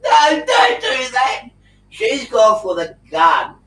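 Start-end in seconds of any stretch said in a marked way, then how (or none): 0.0-1.5 loud, slow, high cry of don't; panicked shout. Retold or imitated. Shouting at the officer reaching for her gun, loud and slow. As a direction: yell to stop her, half in jest. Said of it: "I go".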